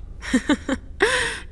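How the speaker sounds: bright laugh breaking high; a short amused laugh; light high laugh. Acted. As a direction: laugh fondly at the child's joke. Amused by child's remark.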